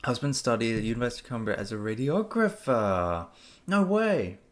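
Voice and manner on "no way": high, louder "no way"; delighted disbelief; surprised. Real, not acted. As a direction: genuinely caught off guard. Surprised at a listener's news.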